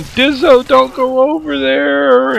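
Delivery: high, loud, drawn-out retold yell; yelling a warning. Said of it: "and I'm yelling".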